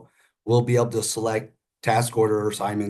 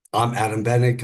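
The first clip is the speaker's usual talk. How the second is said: ordinary talk, nothing marked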